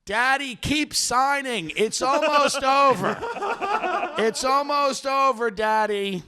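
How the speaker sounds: high, slow, sing-song coaxing of a dying father; coaxing son. Retold or imitated. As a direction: soothe Daddy into signing, slow and high. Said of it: "his son would look at him and go".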